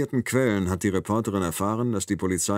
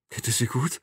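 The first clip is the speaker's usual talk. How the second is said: trembling voice; 4 semitones higher than usual. A narrator's reading. Doctor asking if his daughter is fine, mild tremble. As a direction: ask fearfully, voice unsteady. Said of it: "fragt Stanton mit zittriger Stimme".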